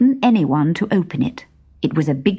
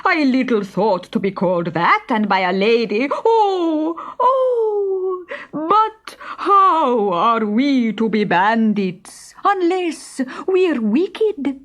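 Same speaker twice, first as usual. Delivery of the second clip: slow, mock-tearful lament; hammed-up bandit sob; a comic bandit wailing in mock despair; wounded bandit; 8 semitones higher, slower than usual. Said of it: "gasped Benny with a horrified look".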